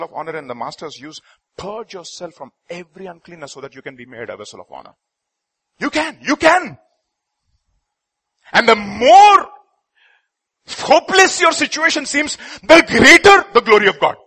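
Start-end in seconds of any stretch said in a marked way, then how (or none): 5.8-14.2 loud, high preaching cry; fiery preaching. Acted. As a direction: proclaim it with full force. Preaching at full force.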